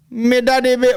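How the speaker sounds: high-pitched mock Jamaican patois; hammed-up Jamaican accent, way up high; squeaky party boast in Jamaican patois. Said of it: "He's at parties like".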